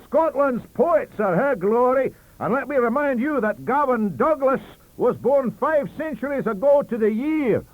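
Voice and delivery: loud, high, proud Scots boast; shouting. Acted. Librarian shouting down the table about poets. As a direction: trumpet Scotland's poets. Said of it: "shouted our librarian".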